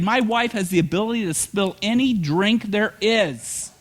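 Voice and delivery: ordinary delivery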